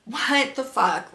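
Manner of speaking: slow, dragged-out what the fuck; mock exasperated, said slowly; drawn-out disbelief. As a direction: stretch each word in weary disbelief. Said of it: "because I was like".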